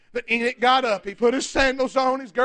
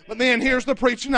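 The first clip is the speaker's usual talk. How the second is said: normal talking voice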